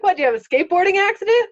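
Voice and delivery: high, loud, fast sarcastic question from the boss; mock incredulous boss. Boss scoffing at her bruised arm, high, loud, sarcastic. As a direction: play the boss, scoffing at an obvious lie.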